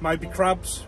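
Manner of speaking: ordinary talk, nothing marked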